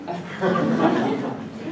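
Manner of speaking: a brief unvoiced chuckle; breathy laugh. Real, not acted. Laughs at her own stumble.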